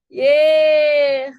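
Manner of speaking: loud, drawn-out yay; one long celebratory whoop of yay; cheer. Shown as a demonstration. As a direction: cheer the group on loudly. Cheers to end laughter exercise.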